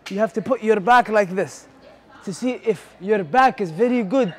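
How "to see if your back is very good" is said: ordinary talk, nothing marked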